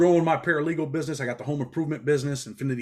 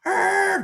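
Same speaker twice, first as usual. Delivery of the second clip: mock yell; 6 dB louder than usual. Driver's shout as he leaves.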